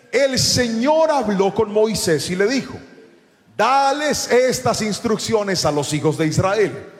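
slow, loud proclamation of the verse; emphatic scripture reading. Real, not acted. Preacher reading God's instructions to Moses, slow and proclaimed. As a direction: stretch key words for the congregation. Reads scripture aloud to congregation.

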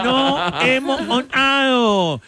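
mock garbled speech. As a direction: drag it out with mangled consonants.

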